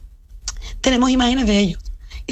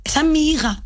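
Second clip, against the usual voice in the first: put-on higher, outraged retelling of a mother protecting her child; indignant mother; 5 semitones higher than usual. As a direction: raise the pitch in disbelief, defending your daughter. Sister confronts man photographing daughter. (hermana indignada defendiendo a su hija, voz más aguda)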